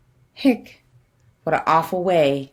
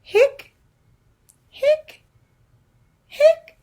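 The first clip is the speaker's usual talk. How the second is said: high squeaky hic hic hic; squeaky hiccups; much slower than usual. Acted. Skeleton hiccuping to the kids, high squeaky hics.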